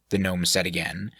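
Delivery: ordinary delivery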